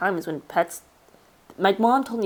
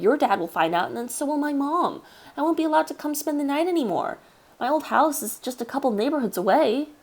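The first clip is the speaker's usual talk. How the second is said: put-on higher worried boy voice; pleading kid; 7 semitones higher than usual. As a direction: kid begging his friend. Child pleading with Josh.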